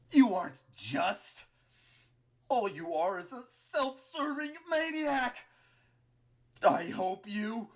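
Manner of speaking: slow, high, loud denunciation; anguished fury. A narrator's reading. Dying Martlet condemning Clover, anguished fury. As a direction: spit out a desperate, drawn-out accusation.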